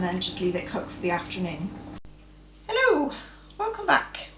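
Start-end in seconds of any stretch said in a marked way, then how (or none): 2.7-4.3 high, sing-song Irish greeting; lilting leprechaun-style Irish accent. Pretend. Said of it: "That was my Irish accent".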